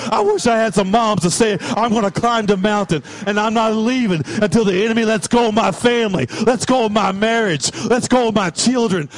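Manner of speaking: loud, driving declaration; fervent preaching. Real, not acted. Preacher urging parents to fight for family, loud and fervent. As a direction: preach it at full force, insistent.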